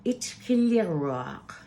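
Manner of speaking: usual speaking voice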